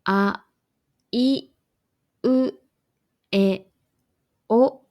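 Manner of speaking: あ、い、う、え、お each said alone, short and clear; vowel drill; five Japanese vowels. Shown as a demonstration. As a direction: spell out あ い う え お one by one with pauses. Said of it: "日本語には五つの母音".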